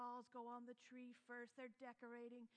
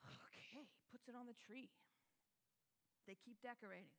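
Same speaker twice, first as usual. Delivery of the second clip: ordinary talk, nothing marked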